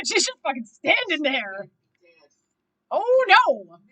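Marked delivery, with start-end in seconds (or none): 2.9-3.8 loud, high, alarmed exclamation; dismayed cry. Real, not acted. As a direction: cry out at a sudden bad turn. Dismayed reaction to the story.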